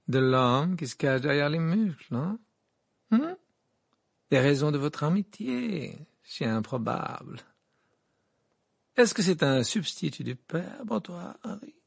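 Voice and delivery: affected, insinuating reporter; coaxing gossip journalist. Acted. Rita presses Harry about Hagrid, coaxing. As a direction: wheedle an interview out of them.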